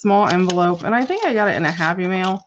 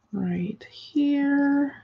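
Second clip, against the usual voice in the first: whispering; 7 semitones higher, 3 dB quieter than usual. Real, not acted. Whispered aside while gluing.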